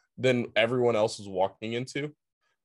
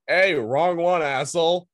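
louder, higher call-out; mock parental yell; 6 semitones higher, 5 dB louder than usual. Parents yelling at son for wrong car, mock loud. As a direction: snap at the kid for the wrong car. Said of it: "they were like".

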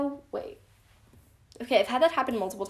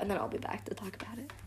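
whisper; 11 dB quieter, much faster than usual. Real, not acted. Whispered sign-off.

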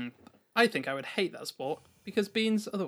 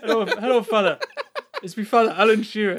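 put-on Geordie accent; 3 semitones higher, 6 dB louder than usual. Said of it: "do a Geordie accent".

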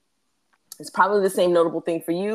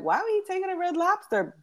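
mock disbelief; 12 semitones higher than usual. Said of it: "I was like".

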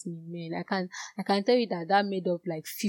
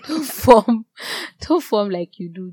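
laughing rebuke; 3 semitones higher, 9 dB louder, slower than usual. Real, not acted. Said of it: "laughing rebuke".

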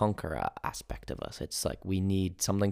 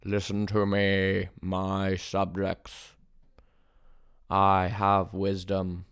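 slow, drawn-out proclamation; prophet on a hill; slower than usual. Pretend. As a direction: proclaim like a prophet addressing mortal subjects. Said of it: "Pretend you're a prophet on the hill".

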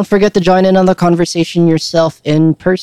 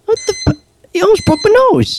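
high, shocked and outraged; shocked outrage. Acted. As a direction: cry out after being punched. Acted skit, punched in nose.

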